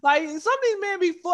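usual speaking voice

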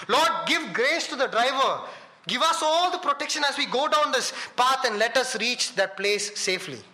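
high, emphatic plea to God, retold; fervent prayer. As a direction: pray out for protection, pitched high. Voices the believers' prayer.

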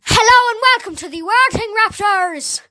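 loud, high-pitched Irish accent attempt. Shown as a demonstration. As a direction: belt out the greeting in an Irish accent. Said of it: "do Irish".

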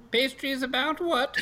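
put-on high-pitched old lady voice; old pastry seller. Old lady queries a customer, high questioning voice.

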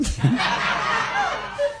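shrieking burst of laughter; a high squealing laugh. Real, not acted. Laughing at own joke. (ngguyu banter nyaring)

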